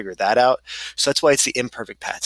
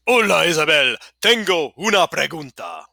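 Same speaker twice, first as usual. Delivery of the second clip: lightly hammed-up, higher-pitched character voice; dramatic texter; 5 semitones higher, 5 dB louder than usual. Miguel texting his ex a question, mock dramatic. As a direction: act out the text with mock drama.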